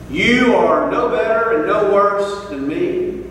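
ordinary delivery

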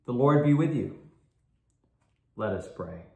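ordinary talk

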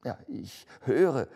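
frightened Scrooge. Acted.

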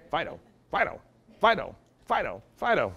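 mock calling to an unresponsive dog. Imitating client calling an ignoring dog.

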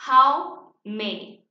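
"how many" very slow and loud, each word separate; teacher's loud, stretched-out "how many"; pronunciation model. Shown as a demonstration. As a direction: lead a repeat-after-me "how many". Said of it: "repitan en casa".

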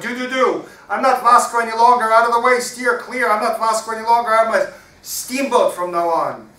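high, very loud play shout; Vasco hollering. Acted. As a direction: holler it out, stretching the end. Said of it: "and he hollered".

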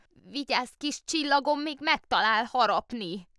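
high, anxious warning; the aunt warning her nephew about the bear. A narrator's reading. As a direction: fret over him protectively. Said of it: "óvta".

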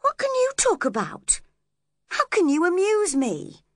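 very high-pitched, scornful questions; exasperated, high and insistent; cross. A narrator's reading. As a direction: demand it crossly, pitched right up. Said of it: "she asked crossly".

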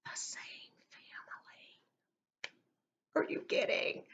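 whispered in disbelief, almost no voice; stunned whisper. Retold or imitated. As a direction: hush it like a shocked secret. Shocked aside about same family.

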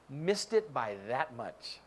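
slow, soft "missed it by that much"; understated slow punchline; slow catchphrase. Retold or imitated. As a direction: drag it out like the old TV line. Catchphrase, slowed down.

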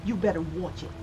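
high, soft New York wise guy; New York accent. Acted. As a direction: warn them like a New York tough. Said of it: "It'll sound like that in New York".

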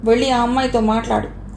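ordinary delivery

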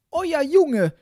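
high, shrieking wife; outraged woman's cry; loud outcry. A narrator's reading. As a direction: burst out loudly, pitched very high. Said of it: "eilte dann mit lautem Geschrei".

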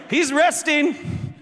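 high, stretched-out heckle; Elijah's taunt. Acted. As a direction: call it out as a mocking jeer. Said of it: "This is Elijah".

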